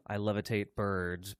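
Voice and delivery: low, slow deadpan delivery. Retold or imitated. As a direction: toss off the joke softly with no expression. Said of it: "that deadpan, just nothing".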